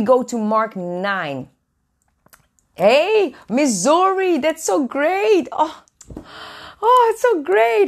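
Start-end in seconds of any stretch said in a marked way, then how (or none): 2.7-7.9 high, delighted hello; excited greeting. Real, not acted. Welcoming a viewer from Missouri, pitched up with joy. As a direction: greet them way up in pitch, thrilled.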